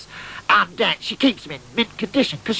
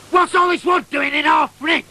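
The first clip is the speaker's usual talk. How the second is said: higher, louder shouted question; mum shouting; 8 semitones higher, 4 dB louder than usual. Retold or imitated. Mum yelling up about wood in the fridge, loud. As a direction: call up from the kitchen, demanding.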